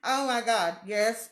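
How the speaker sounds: ordinary talk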